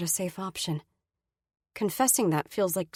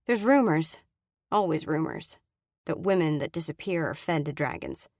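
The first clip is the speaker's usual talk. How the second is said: ordinary delivery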